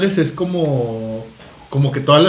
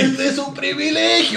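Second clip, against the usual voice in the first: mock outrage; 9 semitones higher, slower than usual. Mocking privileged friend.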